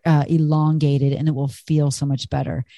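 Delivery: ordinary talk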